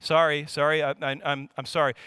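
low, stammered quick sorry; poor apology. Shown as a demonstration. Showing an apology that only fixes it, low and stumbling. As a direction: mumble a repeated, half-hearted sorry. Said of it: "Here is not a great apology".

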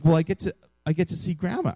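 high, lightly voiced eager child; retold six-year-old. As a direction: lightly imitate a six-year-old's happy realization. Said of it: "my six-year-old".

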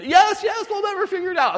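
high-pitched mock eager begging; eager pleading. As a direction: beg for the answer, high and eager. Listeners beg for the answer.

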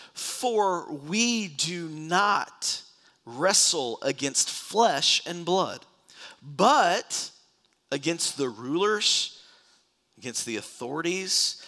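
slow, weighty proclamation with long pauses; deliberate, forceful preaching from the text; emphatic scripture reading. Acted. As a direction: land each phrase like a rallying charge.

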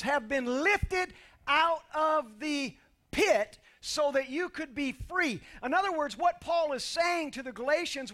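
loud, high, rising sermon climax; preaching at full force. Acted. Pastor to congregation, rising to a full-force preached climax.

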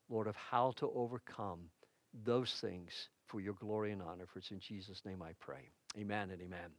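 hushed, earnest petition to God; prayer. Real, not acted. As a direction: lead a congregation quietly in prayer. Leads the congregation in prayer.

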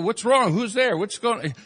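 ordinary talk

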